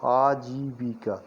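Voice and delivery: "आजीविका" slowly drawn out as "aa-jii-bii-kaa"; syllable by syllable. Shown as a demonstration. Teacher sounds out आजीविका for learners, slow and syllabled. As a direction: lengthen every syllable of "आजीविका".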